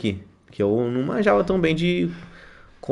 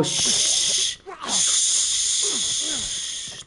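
shushing; 11 semitones higher, 4 dB quieter than usual. Real, not acted. Sneaking up on an enemy.

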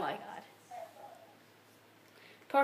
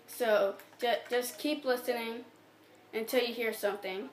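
ordinary talk

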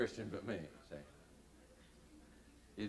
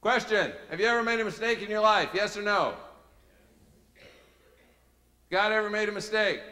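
loud, high preacher's questioning; challenging questions; 9 semitones higher, 8 dB louder than usual. Retold or imitated. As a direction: fire the questions out at the congregation. Acted leader firing questions.

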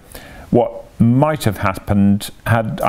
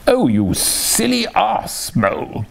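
much higher, slow exasperated outburst; crying out; 9 semitones higher, slower than usual. Acted. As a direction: wail at Mole for spoiling it. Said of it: "cried Toad".

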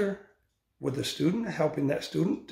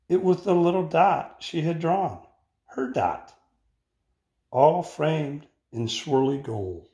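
put-on expressive read-aloud for children; picture-book reading voice; story narration. A narrator's reading. Teacher reads picture book aloud.

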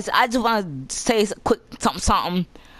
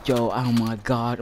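ordinary talk

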